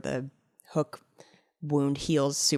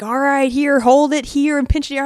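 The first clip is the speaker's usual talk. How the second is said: louder and higher, bossy guide imitation; mock guide instructions; 7 semitones higher, 5 dB louder than usual. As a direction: play the fishing guide barking orders. Guide giving instructions, retold.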